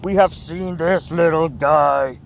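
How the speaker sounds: usual speaking voice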